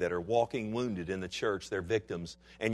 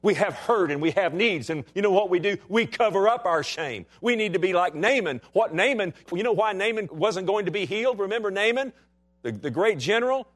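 higher, louder preacher's cry; full-force preaching; 9 semitones higher, 4 dB louder than usual. Acted. Pastor exhorting his congregation, in full-force preaching. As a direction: preach it out to the church, rising.

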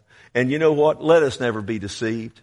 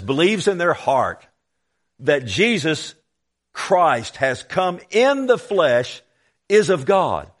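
forceful scripture reading; 3 semitones higher, 4 dB louder, slower than usual. Acted. Preacher reads the verse to congregation, forceful preaching. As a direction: proclaim the verse, drawing out key words.

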